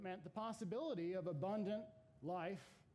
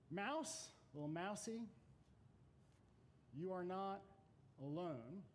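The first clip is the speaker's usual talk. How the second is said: slow, measured poem recitation; unhurried reading with long pauses; 5 dB quieter, slower than usual. Acted. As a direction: read the stanza with gentle gravity. Reads the Burns stanza aloud.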